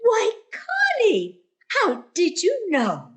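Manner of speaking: high, slow, drawn-out fake astonishment; feigned delight. Landlady feigning surprise that Connie guessed the gift, high and drawn out. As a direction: act thrilled as if it were unexpected.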